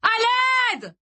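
drawn-out cry for help; desperate yell. A narrator's reading. Boy running like a madman, yelling desperately. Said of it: "en hurlant désespérément".

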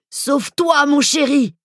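high urgent shout. Acted. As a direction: cry out a warning. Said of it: "trouva la force de crier".

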